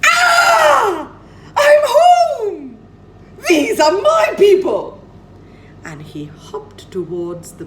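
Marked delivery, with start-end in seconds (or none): 0.0-5.0 very high, loud, ecstatic screech; a lost bird finally finding home; joyful shriek. Acted. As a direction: scream it out with overjoyed relief, close to tears. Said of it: "And he shrieked to himself".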